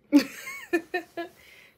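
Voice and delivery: a high laugh at herself; burst of laughter. Real, not acted. Laughing at herself to viewers after admitting she's been crying.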